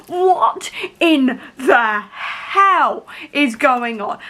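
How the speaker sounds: very slow, every word stretched; stunned disbelief. Real, not acted. Reader reacting to Valkyrie's reveal, astonished. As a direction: spell out real shock word by word.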